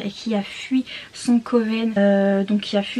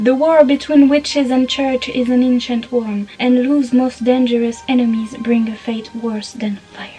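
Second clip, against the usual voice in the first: slow, weighty trailer narration with French accent; dramatic English reading; 4 dB louder than usual. Shown as a demonstration. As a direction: build drama phrase by phrase, landing loud. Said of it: "mon super accent anglais". (lecture dramatique et lente, accent français)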